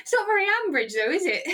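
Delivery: laughing through the tag question; chuckling at the idea; amused, giggling aside. Real, not acted. Laughing at spy theory.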